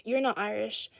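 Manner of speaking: normal talking voice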